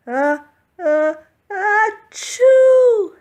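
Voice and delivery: high, loud building sneeze; big sneeze. A narrator's reading. Santa sneezing from soot in the fireplace, loud sneeze. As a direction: wind up and let the sneeze burst. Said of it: "sneezed Santa".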